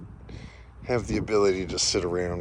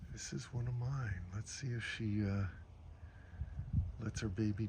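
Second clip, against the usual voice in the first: hushed; 11 dB quieter than usual. Real, not acted. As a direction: whisper so you don't disturb her. Quiet near a new mother.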